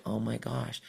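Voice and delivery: very soft, stunned quote; retold hushed awe. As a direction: imitate a quiet dawning realization. Retold client's stunned realization.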